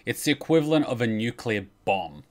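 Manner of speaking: ordinary talk, nothing marked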